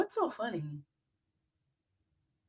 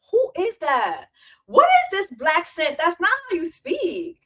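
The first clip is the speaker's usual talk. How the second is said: indignant; 8 semitones higher, 5 dB louder than usual. Real, not acted. Mocking, indignant rant.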